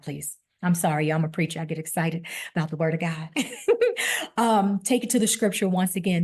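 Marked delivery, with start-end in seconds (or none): 3.3-4.3 quick high-pitched laugh; short laugh. Real, not acted. Preacher after apologizing for getting excited, laughing.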